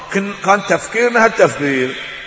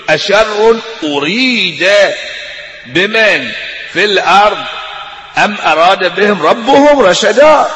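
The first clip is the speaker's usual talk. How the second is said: Quran recitation; 3 semitones higher, 3 dB louder, slower than usual. Acted. Reciting the jinn's words.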